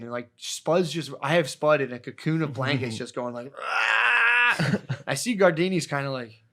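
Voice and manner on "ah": high, loud cry; tripping yell. Retold or imitated. Imitating Spud wailing from his blanket cocoon. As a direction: let out a high wail.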